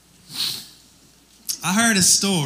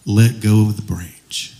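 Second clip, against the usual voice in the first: low, measured command; voice of God; 8 semitones lower, 3 dB louder than usual. Acted. God instructs the hanging jogger, deep voice. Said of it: "God comes back and he says".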